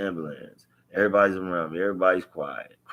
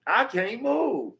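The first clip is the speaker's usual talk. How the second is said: retold yell; 11 semitones higher than usual. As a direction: shout it up high, desperate and pleading. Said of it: "I'm, I'm yelling at her".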